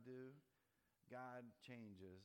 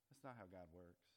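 ordinary talk, nothing marked

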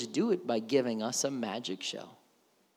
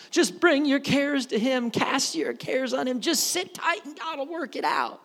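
higher, sing-song mock of a comforting sermon; mock popular message; 10 semitones higher, 4 dB louder than usual. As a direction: parody the easy feel-good message. Mocking a popular church message.

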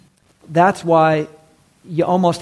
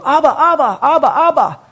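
put-on high, loud child calling for dad; little Hebrew kid; 9 semitones higher, 4 dB louder than usual. Preacher imitates a kid at the Wailing Wall, high child's call. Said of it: "some little, uh, Hebrew kid go".

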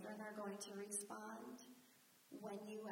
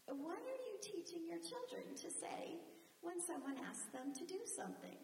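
usual speaking voice

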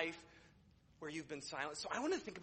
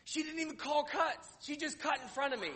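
mock outrage; 7 semitones higher, 4 dB louder than usual. Mock outrage at queue cutter.

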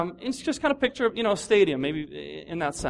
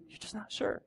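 breathy whisper, much softer; whispered doubt; 14 dB quieter than usual. Acted. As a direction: let the doubt slip out as a whisper. Repeating the doubt softly.

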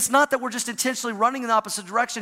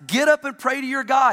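higher, louder captain's shout; 6 semitones higher, 5 dB louder than usual. Retold or imitated. Captain shouting at sleeping Jonah. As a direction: shout the order, a mild mock yell. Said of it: "he shouted".

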